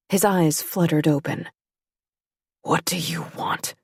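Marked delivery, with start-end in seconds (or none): 2.6-3.7 hushed and low, barely above a breath; low clear whisper. A narrator's reading. Injured Remy to Claire, low quiet whisper. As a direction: let it come out low and quiet, spent. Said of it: "in a low, clear whisper".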